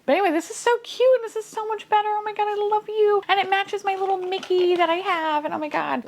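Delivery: high-pitched adoring squeal; squealing with delight over something cute; gushing. Real, not acted. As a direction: gush in a high, excited rush. Gushing over her cute toy.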